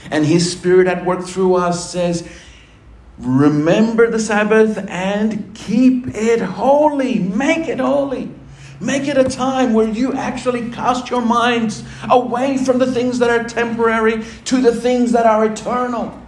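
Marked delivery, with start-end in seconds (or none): 3.2-11.1 preaching. Acted. Preaching the commandment.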